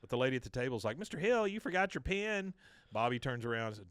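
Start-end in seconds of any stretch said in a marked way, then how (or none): 1.0-2.5 high, louder call after someone; imitated clerk calling out. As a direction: play the lady at the table calling him back. Table clerk calls after fleeing man.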